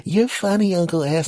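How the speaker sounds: put-on very high child's voice; little nephew teasing his uncle; little kid voice. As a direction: play the giggling nephew. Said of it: "you're gonna be laughing, going".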